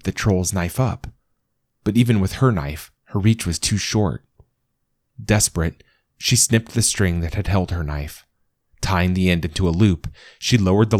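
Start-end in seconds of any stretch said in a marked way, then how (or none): none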